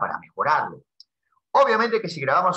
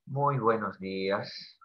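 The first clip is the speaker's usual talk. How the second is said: slow and much softer, lower, sad "Muy buenos días"; sad greeting; 4 semitones lower, 7 dB quieter, much slower than usual. Shown as a demonstration. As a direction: say good morning as if heartbroken. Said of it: "O tal vez de tristeza". (saludo triste, lento y suave)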